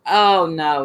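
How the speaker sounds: ordinary talk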